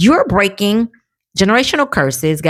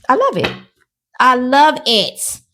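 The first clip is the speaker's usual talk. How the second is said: delighted; 7 semitones higher than usual. Real, not acted. Reader gushing over her own reading. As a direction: savour each word with glee.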